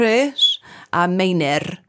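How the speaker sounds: slow Rhys a Meinir with Welsh vowels; Welsh pronunciation. Shown as a demonstration. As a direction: Rhys a Meinir rolled slowly, Welsh style. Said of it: "I'm doing the Welsh accent for names".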